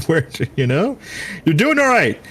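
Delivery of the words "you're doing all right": high-pitched encouragement. Real, not acted. Encouraging a struggling player.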